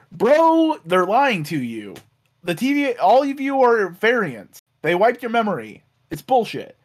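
high, emphatic imitation of pleading; mock-urgent attempt to convince a friend; insistent revelation. Voicing Loki urgently revealing truth.

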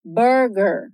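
burger with a strong retroflex R in both syllables; English burger, R curled; American R. Shown as a demonstration. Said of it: "Então, veja".

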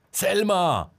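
high alarmed shout across the yard; frantic shout for Selma; shouting a name. A narrator's reading. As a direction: yell her name in panic. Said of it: "schrie Marcel".

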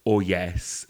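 ordinary delivery

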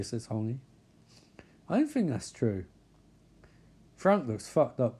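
ordinary talk, nothing marked

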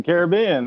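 Caribbean as KARE-uh-BEE-un; Caribbean with the third syllable strongest; stress on third syllable. Shown as a demonstration. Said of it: "How do you say that?".

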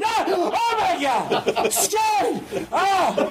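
very high hammed-up screams; scared professor. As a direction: shriek in mock terror. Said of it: "he gets just as scared each time".

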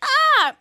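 high-pitched, loud shriek; hammed-up squeal of horror; mock scream. Retold scream at the poking.

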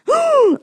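high, loud re-enacted gasp; big hammed-up gasp; throat-caving gasp. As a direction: suck in a huge gasp of shock. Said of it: "it was one of them gasps".

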